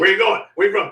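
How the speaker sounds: loud, high imitated demand; checkpoint challenge. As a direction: challenge strangers at a checkpoint, loudly.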